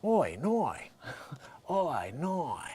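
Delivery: Australian accent. Shown as a demonstration. Comedian demonstrates the trick to listeners, soft Australian accent. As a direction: try out drawn Australian vowels quietly. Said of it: "you can get into the Australian".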